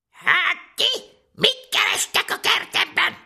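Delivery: very high, loud, rasping witch voice; raspy old crone. Acted. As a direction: rasp it at trespassing children. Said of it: "kérdezte reszelős hangon".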